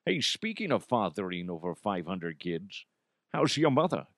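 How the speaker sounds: mock Scottish movie-star impression; imitation of a suave old film star; Connery. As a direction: slip into a sly celebrity impression. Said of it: "I always think it's funny when Connery makes an appearance".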